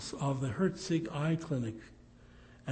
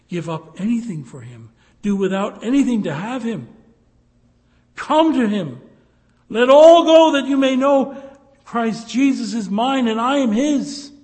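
builds from firm to full shouting, much higher and louder; rising to a shout; 8 semitones higher, 14 dB louder than usual. Real, not acted. Preacher pleading with listeners, building to a shout. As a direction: preach it out at the top of your lungs.

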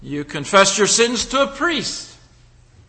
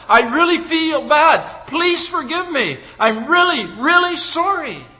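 pleading defendant; 7 semitones higher than usual. Pretend. Murderer pleads with the judge, hammed-up begging. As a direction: beg the judge with mock remorse.

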